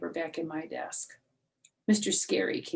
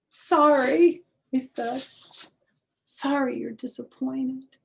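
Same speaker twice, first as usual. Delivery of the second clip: put-on higher, small apologetic child; tearful child; 8 semitones higher, 7 dB louder than usual. Junie B apologizing tearfully to her teacher, higher. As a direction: apologize close to tears.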